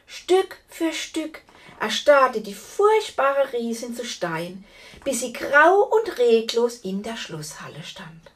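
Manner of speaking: ordinary delivery, nothing marked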